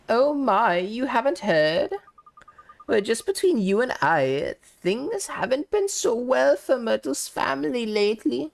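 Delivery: a nosy, kindly neighbour cooing over gossip; sweet gossiping old lady. Acted. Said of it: "such an authentic voice for that character".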